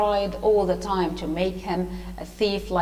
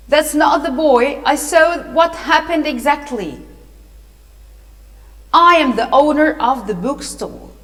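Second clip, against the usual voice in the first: loud, raised witness testimony; emphatic declaration; 4 semitones higher, 7 dB louder than usual. A narrator's reading. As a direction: speak up firmly to halt an injustice. Witness intervenes to clear the boy.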